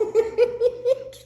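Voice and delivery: very high squealing laugh; real high-pitched cackle. Real, not acted. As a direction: let out a squealing giggle. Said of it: "You are so in trouble".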